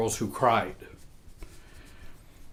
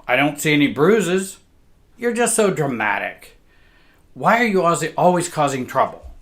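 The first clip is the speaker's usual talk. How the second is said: mother scolding; 4 semitones higher, 7 dB louder than usual. Retold or imitated. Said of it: "She would say".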